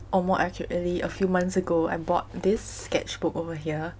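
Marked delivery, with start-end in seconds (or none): none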